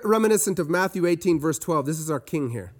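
ordinary talk

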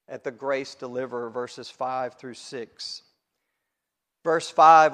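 ordinary talk

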